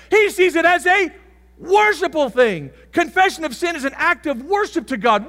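high, loud preacher's shout; full-force preaching. Acted. Preacher shouting to the congregation about confession. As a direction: hammer it home from the pulpit.

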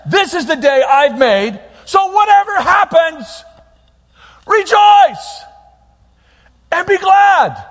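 slow, high, loud proclamation rising to a shout; God declaring to his people, loud and slow; booming God voice. Acted. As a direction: thunder the command to rejoice. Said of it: "So he says".

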